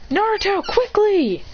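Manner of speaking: high, urgent call to a friend. Acted. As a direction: hurry him along, pitched high. Urging friend across in chase.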